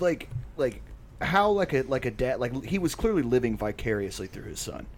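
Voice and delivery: usual speaking voice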